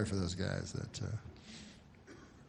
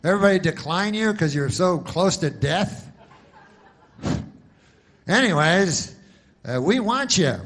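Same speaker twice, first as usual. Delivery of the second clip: pushy insurance pitchman; 6 semitones higher, 7 dB louder than usual. Retold or imitated. Insurance ad voice pitching old people, loud and pushy. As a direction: ham up a cheesy TV insurance ad. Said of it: "so it's always like".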